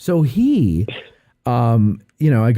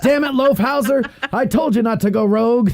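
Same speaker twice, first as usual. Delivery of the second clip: mock police chief; 12 semitones higher than usual. Mock seventies detective chief.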